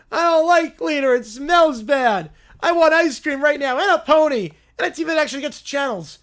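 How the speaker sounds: put-on high, loud, shouting bratty kid; tantrum shout. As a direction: scream your demands like a preschooler in a meltdown. Kid tantrum over cleaner.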